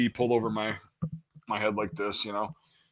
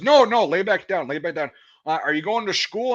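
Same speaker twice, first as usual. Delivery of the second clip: light recruiter voice; 7 semitones higher than usual. Retold or imitated.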